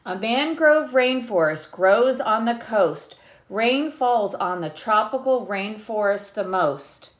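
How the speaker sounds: lightly lilting read-aloud; reading a picture book to children. Acted. Teacher reading the book to her class, lightly lilting.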